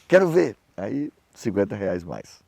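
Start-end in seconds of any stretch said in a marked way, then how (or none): 0.1-0.6 demanding. Retold or imitated. Pastor exige ver a oferta.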